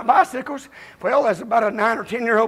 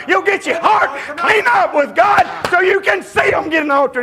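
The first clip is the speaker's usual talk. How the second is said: preaching shout; 6 semitones higher than usual. Real, not acted. Preacher pleading with the congregation, shouting. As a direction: lift it into a full preacher's shout.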